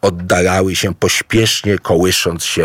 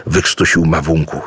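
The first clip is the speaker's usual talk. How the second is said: plain reading voice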